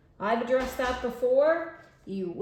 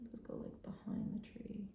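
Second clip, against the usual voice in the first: whisper; 15 dB quieter than usual. Acted.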